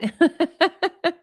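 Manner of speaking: high giggling laugh; giggle. Real, not acted. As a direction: giggle at the joke. Keeps laughing.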